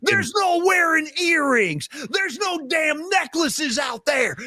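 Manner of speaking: put-on high, drawn-out yell with a Texas accent; yelling Texas coach. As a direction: imitate a Texas coach hollering at his team. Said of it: "He's yelling at the team".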